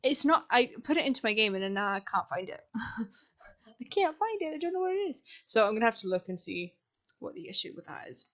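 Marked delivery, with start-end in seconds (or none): 3.8-5.2 high, whiny complaint; mock whine. Can't find the shelf tool, mock whiny and high. 7.2-8.3 soft whispered aside, lower. Real, not acted. Checking the game issue, whispered aside.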